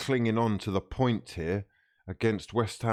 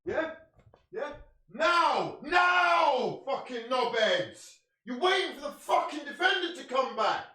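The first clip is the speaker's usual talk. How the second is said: furious yell; 12 semitones higher than usual. Real, not acted. Fan raging at players waiting for the defender, furious yelling. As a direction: bellow in raw frustration.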